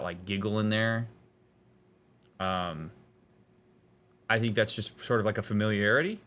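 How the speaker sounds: usual speaking voice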